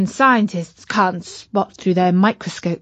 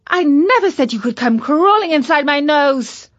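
indignant; 6 semitones higher, 4 dB louder than usual. Acted. Katy scolds the bug.

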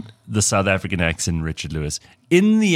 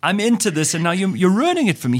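Larry complaining; 10 semitones higher than usual. Retold or imitated. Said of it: "he's like".

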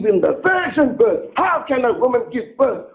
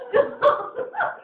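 laugh; 4 dB quieter than usual. Real, not acted. Laughs at own joke.

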